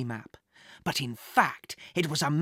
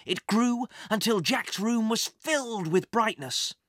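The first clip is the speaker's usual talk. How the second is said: usual speaking voice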